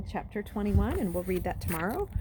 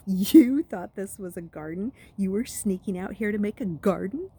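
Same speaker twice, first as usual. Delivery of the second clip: mocking; 2 semitones higher, 6 dB louder than usual. Jackal Boy mocking Luke.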